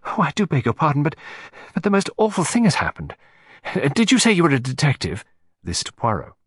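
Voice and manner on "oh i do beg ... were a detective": distressed. A narrator's reading. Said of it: "large tears standing in her eyes".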